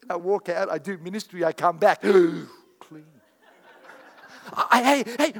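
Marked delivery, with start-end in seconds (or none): 2.0-5.3 gasp, then a laughing "clean" of wonder; gasped delight. Retold or imitated. Preacher reliving his amazement at the tidied hotel room, laughing. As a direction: marvel at the tidy room with a laugh.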